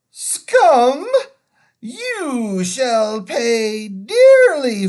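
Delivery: loud, slow, ringing queen's threat; witch's triumphant threat. A narrator's reading. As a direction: toll each word like a great bell. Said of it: "clear like a great bell".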